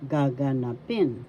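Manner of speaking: Gaganapin with a stressed, falling PIN at the end; stress on fourth syllable. Shown as a demonstration. Teacher showing learners the accent, Gaganapin stressed on PIN. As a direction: land firmly on PIN in Gaganapin. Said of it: "Fourth syllable has the accent".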